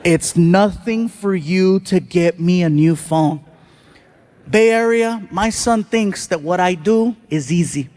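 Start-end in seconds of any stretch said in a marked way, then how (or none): 0.0-3.4 measured, lower disbelieving echo; slow, incredulous repeat. Retold or imitated. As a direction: spell it out slowly, word by word.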